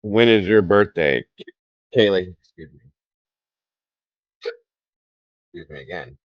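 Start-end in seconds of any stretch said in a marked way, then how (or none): 2.4-6.2 talking through hiccups; hiccuping between words. Real, not acted. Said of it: "Sorry, I got the hiccups".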